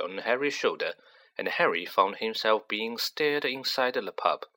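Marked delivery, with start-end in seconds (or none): none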